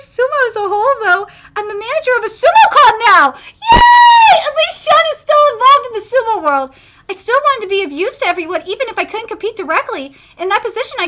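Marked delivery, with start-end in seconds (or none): 3.5-6.8 high, loud cheer; excited cheer. Real, not acted. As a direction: cheer like a delighted fan. Cheering friend still in sumo.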